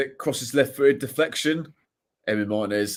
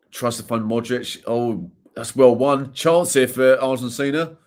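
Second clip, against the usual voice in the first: rising, louder play-by-play call; live commentary; 6 dB louder, slower than usual. Acted. Watching the match, calling the attack with rising excitement. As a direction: call the chance as it builds.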